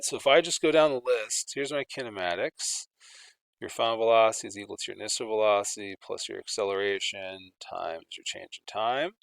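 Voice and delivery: normal talking voice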